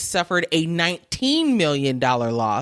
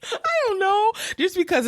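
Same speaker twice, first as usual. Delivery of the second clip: laughing; faster than usual. Real, not acted. Cracking up over Red Lobster.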